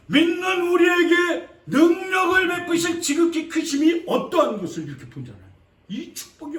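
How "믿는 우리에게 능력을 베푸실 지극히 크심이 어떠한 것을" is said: preaching shout. Real, not acted. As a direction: proclaim the great power. Preacher proclaims Ephesians.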